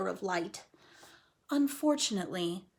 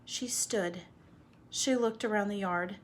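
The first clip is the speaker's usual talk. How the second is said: usual speaking voice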